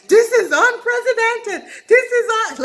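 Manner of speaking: high, loud frenzied outburst; mock going crazy. Host jumping off his chair, hammed-up wild shout. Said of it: "And Andy goes crazy".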